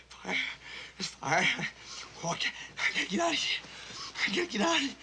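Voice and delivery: fast panicked whisper; breathless terror under fire; frantic whisper. Acted. Fire, trapped.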